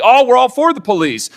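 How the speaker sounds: mock Democrat voice. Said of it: "all of a sudden say".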